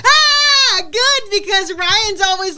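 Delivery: high, loud, delighted squeal; gleeful squeal. Real, not acted. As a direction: crow with glee that he got caught. Gleeful over husband getting caught.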